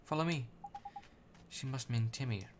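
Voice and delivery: ordinary delivery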